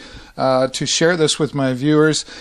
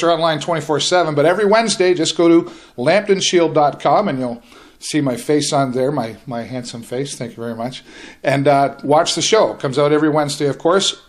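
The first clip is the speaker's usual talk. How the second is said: normal talking voice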